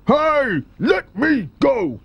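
yelled. A narrator's reading. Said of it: "yelled the enormous crocodile".

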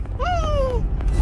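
sharp high gasp of surprise; surprised gasp. Real, not acted. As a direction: gasp in delight.

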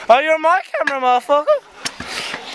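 high startled shout, last word stretched. Real, not acted. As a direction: yell it at the intruder. Friend walks into camera shot.

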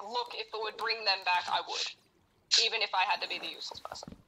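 ordinary talk, nothing marked

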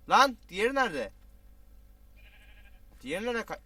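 high, loud search shout; loud calling out. Real, not acted. Gamer yelling for a lost companion in the game. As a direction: call out for the missing one.

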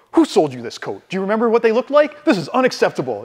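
match the customer's anger right back; matching outrage. Retold or imitated. Tailor shop manager siding with irate customer, mock outraged. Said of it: "snap into customer service".

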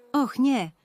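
worried. A narrator's reading. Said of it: "martwi się Milo".

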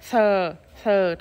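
"Thờ, thờ," with air pushed out; blown th. Shown as a demonstration. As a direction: blow the air out on each "thờ,". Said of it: "thổi chữ thờ ra". (thổi hơi ra ở từng chữ "thờ,")

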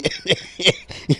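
short startled laugh at the joke; real laugh. Real, not acted. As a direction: burst out laughing, caught off guard. Joke about fishing with him.

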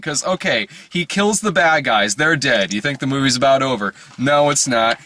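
ordinary delivery

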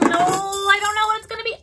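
ordinary talk

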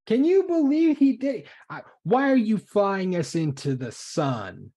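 high, very loud incredulous shout; full-voiced, high-pitched outrage; outraged yelling. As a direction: yell in disbelief at the culprit. [name] confronts him over flying into sun.

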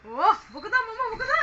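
high, loud playful coaxing. Real, not acted. (উঁচু গলায় খেলাচ্ছলে)

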